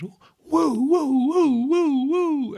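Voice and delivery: high siren woo, rising and falling; siren wail. Shown as a demonstration. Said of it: "Do your best police siren".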